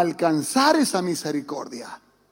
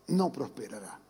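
quiet solemn echo; 11 semitones lower, 10 dB quieter than usual. Shown as a demonstration. Pastor repeats the verse to church, hushed and low. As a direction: drop it much softer and lower, like an echo.

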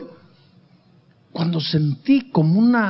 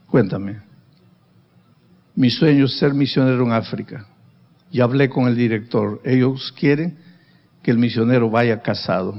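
a hint of a lower earnest young man; young man's voice, lightly voiced; 6 semitones lower than usual. Young man telling her of his mission dream, lightly voiced lower. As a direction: drop the voice lower and keep it steady.

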